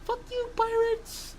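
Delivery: very high, small squeaky voice; squeaky mock insult. Host capping his birthday song to the Pirates, squeaky.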